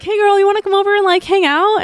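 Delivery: high, quick, sugary invitation; butter her friend up, bright and fast; sweet coaxing invite. Retold or imitated. Inviting her friend over with a hidden motive, sugary high. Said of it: "I was like".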